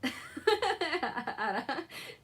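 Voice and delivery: big snorting laugh that rises at the end; real helpless laughter; monster laugh. Real, not acted. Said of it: "A monster laugh".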